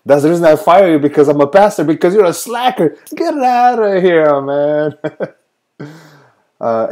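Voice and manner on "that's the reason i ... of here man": mock boss firing. Acts the pastor firing again.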